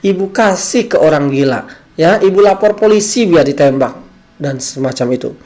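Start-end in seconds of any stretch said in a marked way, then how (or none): 0.1-4.0 higher, louder mock threat; mother threatening a screaming child, loud and high; angry threatening mother. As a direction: threaten the child at full volume. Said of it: "dan berkata".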